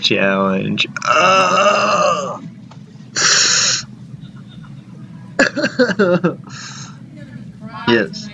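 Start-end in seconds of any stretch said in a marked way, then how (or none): 1.1-6.8 high squeal into a laugh; cringe laugh. Real, not acted. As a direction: let out an embarrassed squeal and laugh. Cringing at old video.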